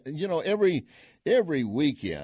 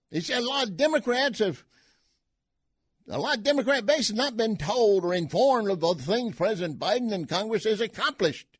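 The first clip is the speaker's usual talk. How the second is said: mock Cajun; 9 semitones higher than usual. Carries on in Cajun voice.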